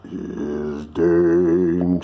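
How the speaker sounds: slow, low bass stage line; low musical-theatre villain priest; high priest role. Acted. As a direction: intone it deep and drawn out. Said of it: "my line was".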